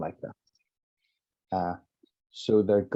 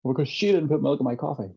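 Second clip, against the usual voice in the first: higher, faster, aggrieved complaint; a touch of the wronged husband, quick and higher; mock-indignant client, higher and quicker; mild indignation; 6 semitones higher, faster than usual. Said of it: "Why did you get angry?".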